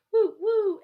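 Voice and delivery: high, loud barked woo; dog barking. Acted. The daunted dog barks loudly. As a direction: bark it like a startled dog. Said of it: "who barked".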